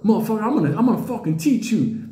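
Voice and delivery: Earl squaring up to the bear; mild mock threat. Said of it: "you hear Earl".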